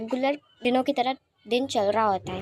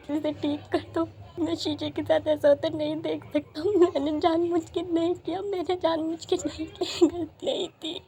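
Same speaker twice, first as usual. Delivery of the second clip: higher, tearful begging; crying plea; 6 semitones higher than usual. Acted. Wing begs Lanzen through tears to fix everything. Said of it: "wing रोते हुए कहता है".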